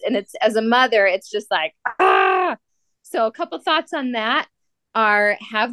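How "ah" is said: frustrated cry. Real, not acted. Mother losing kids in crowds.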